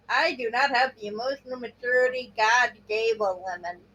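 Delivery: usual speaking voice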